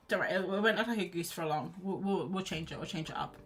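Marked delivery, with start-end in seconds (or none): none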